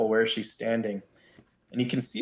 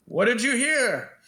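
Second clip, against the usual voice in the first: high-pitched question from an onlooker; speaking up from crowd; 11 semitones higher than usual. Acted. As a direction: call it out high, like an anxious crowd member. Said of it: "then someone speaks up".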